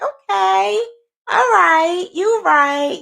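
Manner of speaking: high, sing-song mock agreeing; placating agreement. Crocheter giving in to other people's answers, mock agreeable. Acted reply to answers she read.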